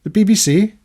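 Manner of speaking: high, startled question; mock-startled woman; startled Israeli lady. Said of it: "She said".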